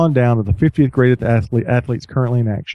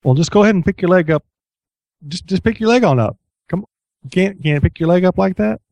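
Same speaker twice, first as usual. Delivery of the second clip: lightly voiced, fast, higher coaxing trainer; a hint of a pushy physio; pushy therapist; 6 semitones higher than usual. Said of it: "a little bit of the".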